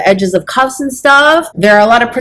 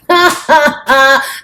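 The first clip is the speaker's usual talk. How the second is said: laughing at own joke; 7 semitones higher than usual. Real, not acted. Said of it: "Oh, I slay me".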